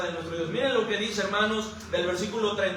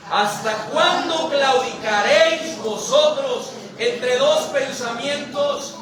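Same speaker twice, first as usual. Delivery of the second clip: slow, loud preaching voice, raised pitch; forceful declamation; 4 semitones higher, 4 dB louder, slower than usual. Acted. Preacher reads Elías's challenge to the people, declaimed slowly and loud. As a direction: proclaim it slowly with drawn-out stresses.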